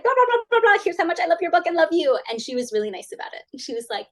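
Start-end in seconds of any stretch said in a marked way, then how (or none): 0.0-2.2 high, fast gushing babble; rambling fan; babbling fan. Retold or imitated. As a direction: spill it all in one breath. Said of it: "it was just like".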